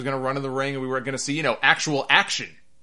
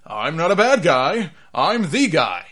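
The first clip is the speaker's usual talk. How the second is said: quoting Roman; 2 semitones higher, 6 dB louder than usual. Retold or imitated. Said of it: "He again used the line".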